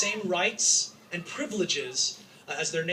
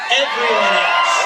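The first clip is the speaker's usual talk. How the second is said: "Everyone else." shouted slowly with "Everyone" punched; rally shout, stretching out "Everyone else."; loud emphatic repeat; 11 dB louder, slower than usual. Real, not acted. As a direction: hammer it home to the crowd, slow and loud. Rally speech, emphatic repetition.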